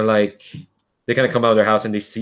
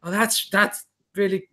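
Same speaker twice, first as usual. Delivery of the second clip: put-on girl's awe; 9 semitones higher, 4 dB quieter than usual. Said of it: "the girls kinda like".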